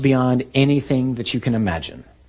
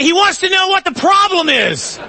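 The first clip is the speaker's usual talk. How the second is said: high comic holler over a gale; shouted relay; 4 dB louder than usual. Acted. Disciple relaying Jesus's words in the storm, high shout. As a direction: bellow the message on, high-pitched.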